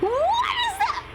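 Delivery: high, slow startled question; suddenly alarmed. Real, not acted. Player spots something strange, startled.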